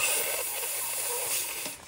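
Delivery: hissing snake-like yes; drawn-out s like a snake. Pretend. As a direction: hiss the s out like a snake. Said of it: "Snake voice".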